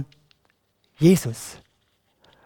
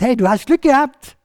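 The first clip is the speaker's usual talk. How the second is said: higher, lively acted call; excited call; 7 semitones higher, 4 dB louder than usual. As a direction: pass on good news brightly to someone. Crowd calls good news to Bartimäus. (freudig aufgeregt zugerufen)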